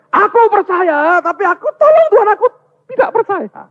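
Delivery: very high, loud anguished plea; desperate screaming. Acted. Father confessing doubt to Jesus, screamed high plea. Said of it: "Dia teriak".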